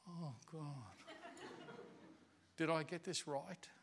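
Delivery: soft, searching prayer under the breath; hushed prayer. Retold or imitated. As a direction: murmur it as a private doubt. Retold private doubting prayer.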